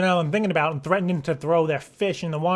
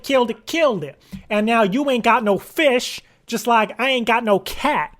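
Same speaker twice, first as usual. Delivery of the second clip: angry rant; 5 semitones higher than usual. Pretend. Caller rehearsing a threat to the company, angry. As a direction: rehearse an indignant tirade, punching the key words. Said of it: "is that too angry".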